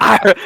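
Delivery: loud, high imitated growl; tiger growl. Man showing co-host how women react, loud tiger growl. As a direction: let out a big playful tiger "arr". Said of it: "They go".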